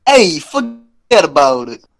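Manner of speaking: hammed-up Italian-American accent; joking Italian wise-guy accent; mock Italian accent. Playing along after being told he sounds Italian, mock Italian accent. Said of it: "Why do you sound Italian now?".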